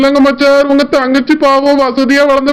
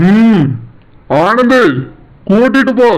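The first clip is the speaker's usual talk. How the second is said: a lightly voiced stern elder brother-in-law; a hint of a stern older brother; 4 semitones lower than usual. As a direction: scold him as the wife's protective brother. Brother-in-law orders the husband.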